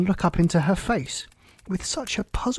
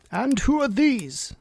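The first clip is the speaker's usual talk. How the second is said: high, slow, haughty question; imperious Queen; 8 semitones higher, slower than usual. A narrator's reading. Queen asks about the gardeners, high and imperious. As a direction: draw out the demand like a queen.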